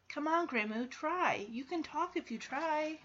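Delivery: soft, tender encouragement; gentle coaxing. Acted. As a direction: coax her kindly to speak. Coaxing unresponsive grandma.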